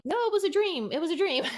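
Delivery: high mock insistence from mom; lightly voiced mom, insisting; imitating mom. Mom insisting it was dream.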